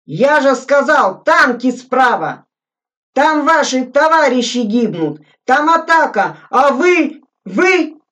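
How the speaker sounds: indignant shout. A narrator's reading. Lieutenant berating the corporal, indignant yelling. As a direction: rage until words fail. Officer yelling, losing words.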